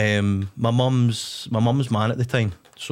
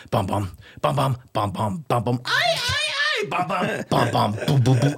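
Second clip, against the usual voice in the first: manic chanting. Retold or imitated. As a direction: chant it like you're bouncing off the walls. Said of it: "I could hear myself smashing my door".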